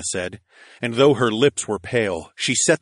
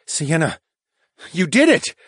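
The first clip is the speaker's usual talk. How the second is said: rising higher and louder with delight; excited praise; 9 semitones higher, 4 dB louder than usual. A narrator's reading. Hero amazed as his wife reverses time. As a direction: cheer her on, amazed.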